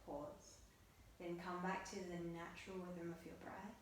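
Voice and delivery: soft, hushed meditation guidance; guided meditation. Acted. Yoga teacher guiding students through breath on their mats, soft meditative voice. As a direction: guide the class gently into breath awareness.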